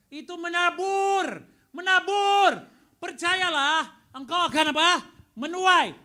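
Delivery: loud, high, slow preacher's cry; preaching at full force. Real, not acted. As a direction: drive it home to the congregation. Preaching sowing and reaping.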